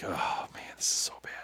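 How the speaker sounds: whispered under the breath; scared whisper. Retold or imitated. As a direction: hush it so the dog won't hear. Frightened aside facing the dog.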